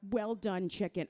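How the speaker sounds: normal talking voice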